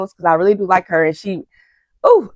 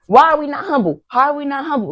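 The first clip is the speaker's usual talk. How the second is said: pitched-up mock indignation; offended Antonio; 7 semitones higher than usual. A YouTuber imitating Antonio answering the judges, mock indignant. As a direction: squeak out the offense lightly. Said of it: "Antonio like".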